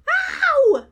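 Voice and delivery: very high, loud "ow" shriek; mock pain yell. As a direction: yelp as if hurt, hammed-up. Mimics pain cry patients don't make.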